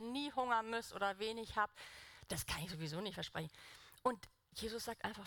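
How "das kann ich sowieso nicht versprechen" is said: ordinary talk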